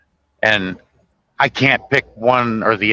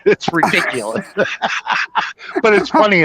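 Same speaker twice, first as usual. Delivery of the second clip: laughing; 7 semitones higher than usual. Real, not acted. Laughing at the movie scene.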